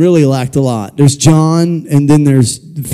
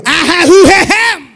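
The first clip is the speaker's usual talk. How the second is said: much higher, slow preacher holler; hammed-up revival preacher warm-up; mock Pentecostal preacher clearing the pipes; 3 dB louder than usual. Shown as a demonstration. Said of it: "Here we go".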